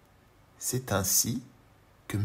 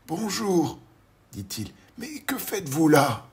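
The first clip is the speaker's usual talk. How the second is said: higher, slowish bewildered voice; puzzled old gentleman; 7 semitones higher, 4 dB louder than usual. Acted. Mr Robinson puzzles at the door, higher voice. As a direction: sound quite sure yet baffled. Robinson greets tiny man.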